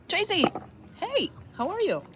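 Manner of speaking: high bright greeting call. Real, not acted. Greeting Tracy from a distance, high calling voice. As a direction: hail a friend across the park.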